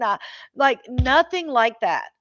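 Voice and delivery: ordinary talk, nothing marked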